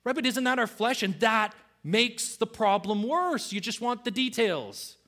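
ordinary talk, nothing marked

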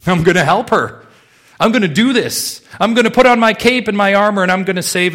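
high, loud, gung-ho bravado; mock-heroic rescuer. Preacher mimicking a believer rushing to save someone, mock-heroic. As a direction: charge in like a self-appointed hero.

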